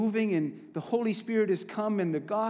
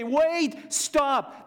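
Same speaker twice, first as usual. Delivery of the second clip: raised, high warning to stop; sharp imperative warning, pitched up; urgent command; 8 semitones higher, 5 dB louder than usual. Shown as a demonstration. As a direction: call out as if halting someone at the kerb. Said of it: "That's the imperative tense".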